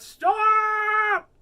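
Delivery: long, high piercing shout; yell at top volume. A narrator's reading. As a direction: yell as loud as you can. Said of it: "Taya yelled as loudly as she could".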